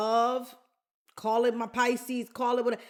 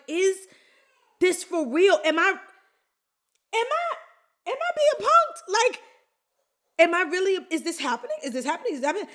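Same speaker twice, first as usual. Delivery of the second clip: disbelief; 8 semitones higher, 5 dB louder, faster than usual. Retold or imitated. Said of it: "I'm like".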